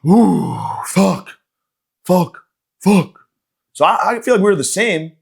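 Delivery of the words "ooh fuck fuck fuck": higher, louder winded cursing; out of breath. Retold or imitated. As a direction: gasp out curses, exhausted. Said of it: "out of breath all day long".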